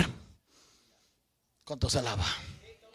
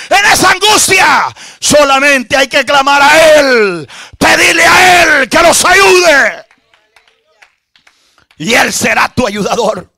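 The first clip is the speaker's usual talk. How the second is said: high, loud revival shout; fervent pulpit cry to the congregation; full-force preaching; 11 semitones higher, 13 dB louder than usual. Real, not acted. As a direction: preach it out loud, stretching the big phrases.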